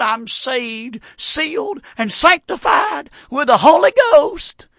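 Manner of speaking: rising, slow, straining declaration; a weak man mustering all his voice; dying man declaring faith, voice climbing; dying man's last strength. Retold or imitated. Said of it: "I mustered all, all the voice I could".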